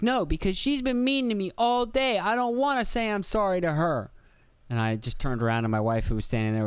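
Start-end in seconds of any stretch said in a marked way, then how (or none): 0.0-4.1 imitating toddler son. Son explaining why he won't apologize, high toddler voice. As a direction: whine the excuse like a three-year-old. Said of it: "he was like".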